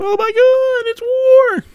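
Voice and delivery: very high-pitched comic wail; over-the-top high shriek of alarm; mock panicked cry. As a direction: squeal in mock terror as war breaks out. Sudden battle acted out.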